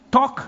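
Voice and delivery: high sharp toc; knock sound. Retold or imitated. Mom hitting the child with the phone, a sharp high toc. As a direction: pop it like a phone hitting a head.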